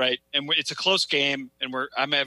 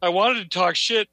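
ordinary delivery

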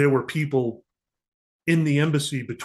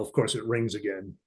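ordinary talk

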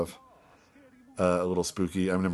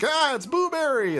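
scared shriek; 3 dB louder than usual. Retold or imitated. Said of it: "they're like".

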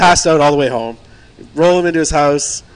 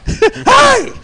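very high, loud squeaky hi; mock drunk greeting, squeaky; drunk cheery hello; 4 dB louder than usual. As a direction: gush like a happy drunk. Drunk friend greeting on phone.

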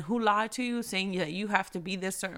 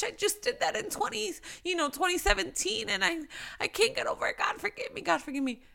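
much higher, fretful pleading voice; mock guilt-ridden whine; 9 semitones higher, 3 dB quieter, faster than usual. Believer fretting to God about an old sin, high mock whine. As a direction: play someone who can't let go of old sin.

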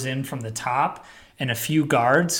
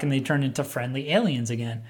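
usual speaking voice

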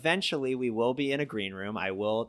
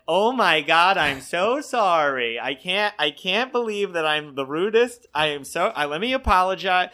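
flustered apology; 7 semitones higher, 6 dB louder than usual. Retold or imitated. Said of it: "And he went".

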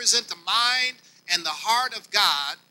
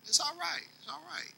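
gentle reassurance; 9 semitones higher, 10 dB quieter than usual. Acted. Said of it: "comforting the men in the pews".

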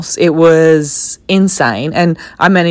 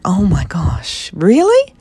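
mock surprise; 3 semitones higher, slower than usual. Retelling her reaction to a rude stranger, mock shocked whisper. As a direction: play up fake shock at a rude remark. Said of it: "you're just like".

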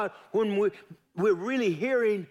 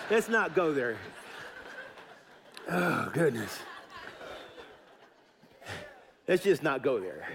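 chuckling through the words; laughing; 3 semitones lower, 3 dB quieter than usual. Real, not acted. Preacher laughing off his own joke to the congregation. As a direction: laugh it off, amused.

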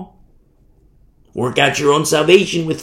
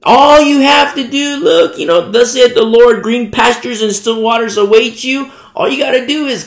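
loud, high mock proclamation; false prophet pitch; 7 semitones higher, 5 dB louder than usual. False prophet urging followers on, mock enthusiasm. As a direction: sell it big, high and loud.

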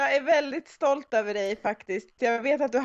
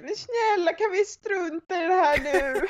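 desperate pleading; 7 semitones higher than usual. Retold or imitated. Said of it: "desperata, stressade, ledsna meddelanden".

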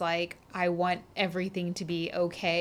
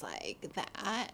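quieter, creaky phrase ending; vocal fry; 12 dB quieter than usual. Shown as a demonstration. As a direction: let the words drop into fry. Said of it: "vocal fry is this thing".

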